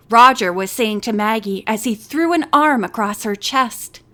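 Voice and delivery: ordinary delivery, nothing marked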